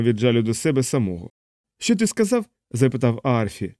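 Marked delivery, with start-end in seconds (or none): 1.8-2.5 high, innocent question; a cheerful, oblivious what; Aarfy asking. A narrator's reading. Aarfy to Yossarian, a high innocent question.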